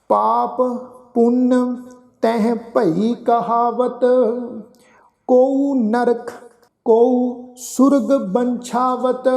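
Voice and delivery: slow, drawn-out scripture chant; slow devotional recitation of the verse. Shown as a demonstration. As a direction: recite each word slowly and reverently.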